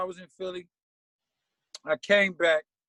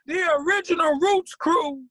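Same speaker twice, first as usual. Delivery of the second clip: high, loud booming show intro; stage announcer; 10 semitones higher, 3 dB louder than usual. Retold or imitated. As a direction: announce the band like a hype MC.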